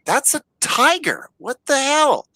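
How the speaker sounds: high-pitched mock disbelief; startled onlookers. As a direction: exclaim it like stunned passers-by, drawn out. Voicing the family spotting a tiger.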